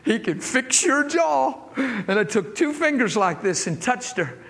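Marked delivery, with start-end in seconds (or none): 0.0-1.5 higher, joyful, almost laughing; joyful faith. Retold or imitated. Preacher to the girl, higher and joyful. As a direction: proclaim it with bubbling delight. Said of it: "I said".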